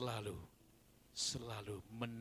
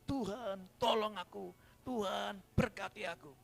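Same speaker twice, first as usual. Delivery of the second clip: mock tearful plea; 8 semitones higher, 3 dB louder than usual. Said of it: "baru nangis".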